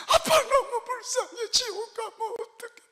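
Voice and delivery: put-on high, slow tearful little child; crying child. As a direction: plead like a small child crying for dad. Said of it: "얼마나 진심으로". (아빠를 붙잡고 우는 아이처럼 높고 느리게 울먹이며)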